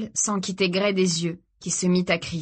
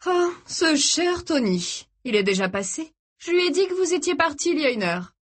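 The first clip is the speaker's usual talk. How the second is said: mocking captor; 9 semitones higher than usual. A narrator's reading. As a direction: toy with them in a raised voice. Ravisseur moqueur. (ravisseur moqueur, voix perchée)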